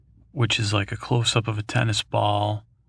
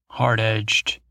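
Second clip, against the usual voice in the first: ordinary talk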